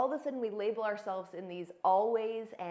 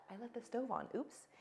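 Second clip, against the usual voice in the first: light relieved aside; 2 semitones higher, 7 dB quieter than usual. Shown as a demonstration. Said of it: "I'm so glad I caught that".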